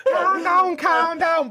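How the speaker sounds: high, loud mock Scouse accent. Teasing a friend's failed [name], in mock Scouse accent. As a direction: ham up a high-pitched comedy Scouse.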